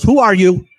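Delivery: retold sharp challenge. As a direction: call it out as the lady challenging a stranger. Retold lady challenges the mystery voice.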